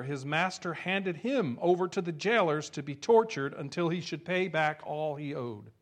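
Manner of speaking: usual speaking voice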